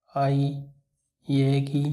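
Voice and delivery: usual speaking voice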